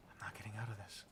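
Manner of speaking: barely voiced, defeated whisper; hushed and resigned; hopeless whisper. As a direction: whisper it as you give up. Character realizes they will die.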